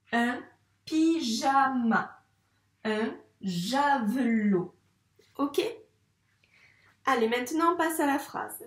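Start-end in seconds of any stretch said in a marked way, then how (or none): 0.1-4.8 "pyjama" and "javelot" read very slowly; slow reading aloud. Shown as a demonstration. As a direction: read each word slowly for the child. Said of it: "Si j'ai été trop rapide".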